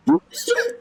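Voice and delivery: high, helpless laughing fit; cracking up mid-sentence; real burst of laughter. Real, not acted. Laughing while recapping the manga chapter to viewers. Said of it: "I'm sorry, I'm laughing".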